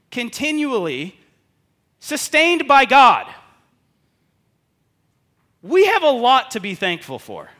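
loud, high, slow building sermon peak; preaching at full force. Real, not acted. Pastor urging the congregation to give thanks, preaching loudly. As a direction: drive it home to the whole congregation.

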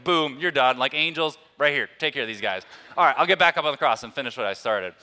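loud, cocky mock commands; hammed-up action-hero takedown, loud and quick; playful bravado. Preacher imagining himself as competitive Jesus ordering angels, loud playful bravado.

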